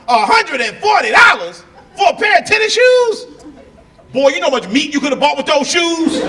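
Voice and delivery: high loud outraged country dad; high, loud mock outrage; dad flipping out. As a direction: explode at the price tag. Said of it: "He flipped out".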